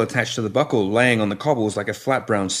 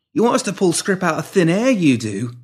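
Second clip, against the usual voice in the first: defiant woman; 9 semitones higher, 4 dB louder than usual. A narrator's reading. Woman retorting to pimp.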